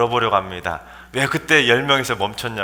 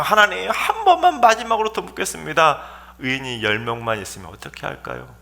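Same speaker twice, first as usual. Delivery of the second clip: last careful plea. Acted. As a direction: plead once more, then shrink back. Abraham's hesitant last bargaining request.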